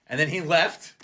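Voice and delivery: ordinary delivery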